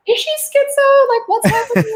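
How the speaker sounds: high, loud mock bystander voice; baffled onlookers. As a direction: squeal it like a confused stranger. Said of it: "People around are like".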